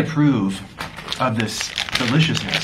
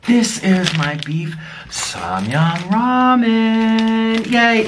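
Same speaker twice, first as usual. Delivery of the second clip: slow, drawn-out showpiece announcement, pitched up; proud reveal; 7 semitones higher, much slower than usual. Real, not acted. Showing off his ramen to viewers, slow and proud. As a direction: unveil it like a prize.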